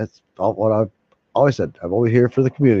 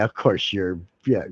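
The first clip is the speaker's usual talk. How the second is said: usual speaking voice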